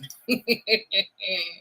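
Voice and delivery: 'laughs' as a hammed-up villain cackle; 'laughs' as a mock evil cackle; evil laugh. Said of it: "That's my evil laugh".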